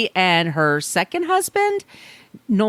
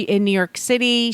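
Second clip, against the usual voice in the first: usual speaking voice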